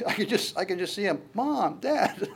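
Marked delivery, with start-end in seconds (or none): 1.3-2.3 high, laughing call of a son; mock son calling. Pastor playing the healed son calling to his parents.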